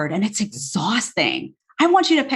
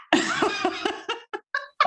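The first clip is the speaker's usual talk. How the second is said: high laugh; 14 semitones higher, 6 dB quieter than usual. Real, not acted. Laughing at her own remark.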